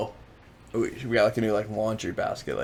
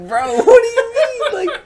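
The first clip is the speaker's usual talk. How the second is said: much higher, louder, slow squeaky voice; mock innocent; 9 dB louder than usual. Protesting to his girlfriend about laundry, squeaky mock disbelief. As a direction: stretch it out in a high squeak. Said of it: "I'm like".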